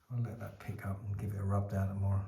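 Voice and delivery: whispered aside to himself; quiet whispered note to self. Real, not acted. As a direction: breathe it under the breath.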